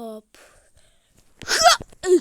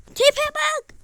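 high, loud taunt; mocking outburst; 11 semitones higher, 8 dB louder than usual. Real, not acted. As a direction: laugh at the goalie's face. Kaleciyle alay. (kaleciyle alay eden yüksek sesli çıkış)

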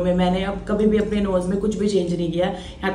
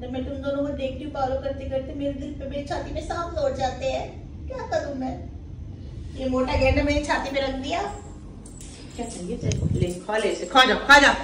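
voicing the cat; 3 semitones higher, 6 dB quieter than usual. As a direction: speak for the sad cat, small and hurt.

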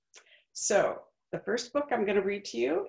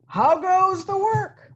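higher, louder, slow farmer's call; 12 semitones higher, 10 dB louder, slower than usual. Acted. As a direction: call out lazily from bed. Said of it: "called the farmer".

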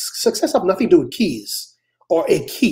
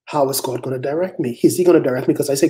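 usual speaking voice

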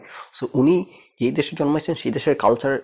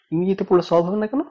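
higher, quick questioning rising to the end; baffled challenge; 7 semitones higher, faster than usual. Retold or imitated. Speaker retelling his challenge to the cleric, baffled rising question. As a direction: throw it back as a puzzled question.

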